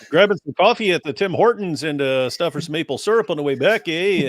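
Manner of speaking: mock Canadian sing-song; Canadian accent. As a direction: play a cartoon Canadian. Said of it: "how we think of Canadians".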